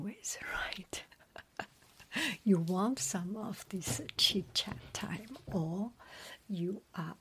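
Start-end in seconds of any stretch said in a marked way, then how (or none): none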